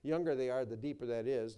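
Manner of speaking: ordinary talk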